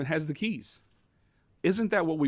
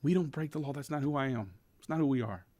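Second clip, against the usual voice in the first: hushed, confiding near-whisper; softly voiced mock whisper; imitated whispering; 7 dB quieter than usual. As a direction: drop your voice as if someone might overhear. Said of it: "And he starts whispering".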